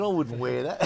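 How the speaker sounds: scornful put-down. Retold or imitated. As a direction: brush the shirt off with scorn. Mocking the shirt.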